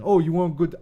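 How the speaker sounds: a hint of Brooklyn accent, hammed up for the chat. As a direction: toss off a brief mock Brooklyn tough guy before giving up. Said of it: "Answer in best Brooklyn accent".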